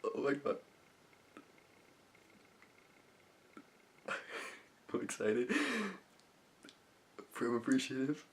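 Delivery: softly thrilled, overcome with laughter; overjoyed visualization. Shown as a demonstration. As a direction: breathe out each feeling in happy disbelief. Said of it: "another example of how I do this".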